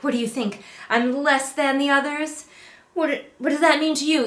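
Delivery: high, fast indignant mimicry; mock offended heroine. Reviewer mimicking the heroine's outrage, high and quick. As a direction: play her insulted, rushing. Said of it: "she's like".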